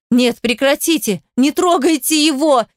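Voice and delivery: desperate cry. A narrator's reading. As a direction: cry out in despair. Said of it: "Заревела я отчаянно".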